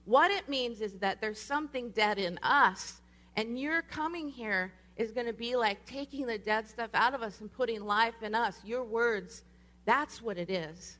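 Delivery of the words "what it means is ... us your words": raised, higher voice for everyone to hear; praying loud enough. Retold or imitated. Praying over the speaker, backpedaling out of fear, loud and insistent. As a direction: pray it out loudly over her, emphatic. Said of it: "as I prayed over her loud enough".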